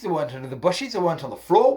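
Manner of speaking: ordinary delivery, nothing marked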